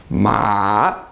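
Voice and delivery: "Ma" low, dipping then coming back up. Shown as a demonstration. Teacher showing the third tone, down and back up. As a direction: dip "Ma" down low, then lift it. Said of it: "goes down and then back up again".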